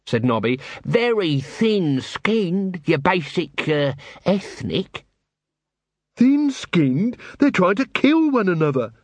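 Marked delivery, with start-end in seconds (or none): 0.8-5.0 slow, higher, halting character voice; hesitant watchman. Acted. Nobby hedging. 6.1-8.9 higher-pitched disbelieving retort; incredulous. Acted. Angua incredulous at Colon's excuse.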